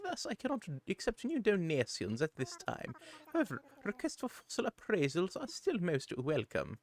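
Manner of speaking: high, fussy owl curator voice; a chirpy, proper museum owl; Blathers voice. Acted. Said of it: "the fun Blathers voice about assessing your fossils".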